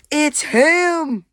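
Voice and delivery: high, loud alarmed cry; startled cry. Acted. Penguin realises the footsteps are the Bobot, startled shout. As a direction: blurt it out in shock.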